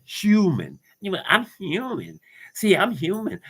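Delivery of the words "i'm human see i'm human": high-pitched mock protest; mocking imitation. Mimicking people hooked on being human, high and mocking. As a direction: mimic a whiny claim in a raised pitch.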